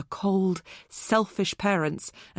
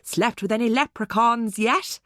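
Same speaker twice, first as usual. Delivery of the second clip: jokey exaggerated Irish accent; satirized Irish accent; 3 semitones higher, 3 dB louder than usual. A narrator's reading. Zoe teasing her friend in a mock Irish accent. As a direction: tease in a hammed-up Irish lilt. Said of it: "a little satirized Irish accent".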